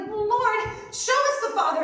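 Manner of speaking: high, loud pleading; panicking. Retold or imitated. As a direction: act out a disciple begging in a panic. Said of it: "Philip's panicking".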